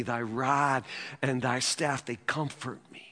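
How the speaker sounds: low, soft, measured recital of scripture; hushed psalm recitation. Acted. Preacher reciting Psalm twenty-three to the congregation, hushed and low.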